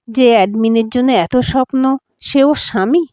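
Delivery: plain reading voice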